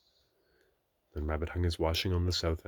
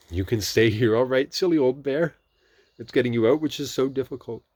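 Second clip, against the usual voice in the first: Christopher Robin; 6 semitones higher, 9 dB louder than usual. A narrator's reading. Christopher Robin answering Pooh.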